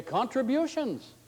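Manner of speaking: very high and slow, savouring the word; excited treasurer. Retold or imitated. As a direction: stretch "Contributions" out in delight. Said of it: "he'll say".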